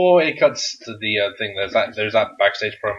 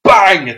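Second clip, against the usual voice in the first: loud mock bang; 6 semitones higher, 11 dB louder than usual. Sound of table crash.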